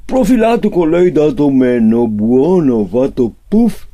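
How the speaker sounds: high, insistent foreign customer; broken Italian customer. Acted. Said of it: "il nero torna in farmacia".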